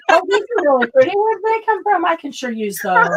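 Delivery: high, quick, gushing; mock delighted old lady. S1 imitating the doily-taking lady finding her own doilies, mock delight. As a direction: gush over the doilies like she's never seen them. Mimicking the roommate receiving gift.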